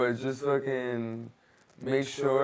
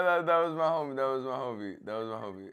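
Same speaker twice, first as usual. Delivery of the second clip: usual speaking voice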